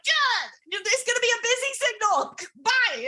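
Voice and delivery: high-pitched, impatient get-off-the-line voice; hurried brush-off. Retold or imitated. Student rushing mom off the phone, high-pitched brush-off. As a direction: cut the caller off in a high voice. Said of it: "I'm like".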